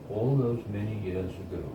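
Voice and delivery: plain reading voice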